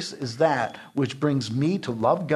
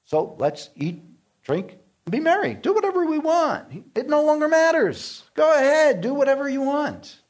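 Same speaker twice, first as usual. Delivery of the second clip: high, carefree mock voice, getting louder at the end; mocking carefree sinner; 12 semitones higher, 3 dB louder than usual. Preacher mocks antinomians with a high, carefree mocking voice. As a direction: lampoon a careless crowd shouting for license. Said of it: "The antinomians have fallen into the belief".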